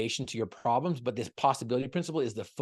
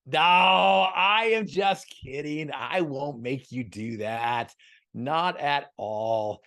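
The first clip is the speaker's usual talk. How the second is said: playful teasing; 3 semitones higher, 6 dB louder, much slower than usual. Real, not acted. Teasing about buying the book.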